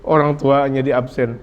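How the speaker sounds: ordinary talk, nothing marked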